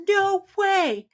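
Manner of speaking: husband amazed his wife has no mind's eye, excited high voice. Retold or imitated. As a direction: play the husband thrilled by the discovery. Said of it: "And he goes".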